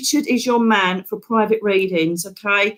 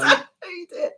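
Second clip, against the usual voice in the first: laughing; 8 semitones higher, 3 dB quieter than usual. Real, not acted. Giggling plea.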